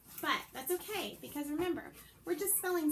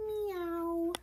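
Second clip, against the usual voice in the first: meow; 6 semitones higher, 5 dB louder than usual. Pretend. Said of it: "sound it out".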